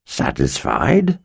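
low mumble around a mouthful; mumbled through food. Acted. Wizard to Chase with his mouth full, low mumble. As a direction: talk through a bite of biscuit. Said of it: "he mumbled around the mouthful".

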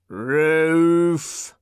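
creaky announcing call; creaking voice. Acted. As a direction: bark out the floor like a worn-down liftman. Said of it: "called a creaking voice".

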